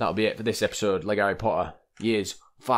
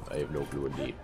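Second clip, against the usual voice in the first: deep mock-pompous voice; 8 semitones lower, 9 dB quieter than usual. Repeated catchphrase, dropping.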